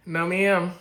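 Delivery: refusing a viewer's question, emphatic. Acted. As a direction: slam the refusal down. Repeats the refusal louder.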